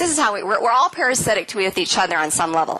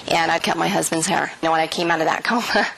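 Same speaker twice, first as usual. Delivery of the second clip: usual speaking voice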